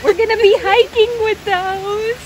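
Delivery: thrilled to see the llamas; excited. Real, not acted. Vlogger telling her camera about the llamas, excited and high.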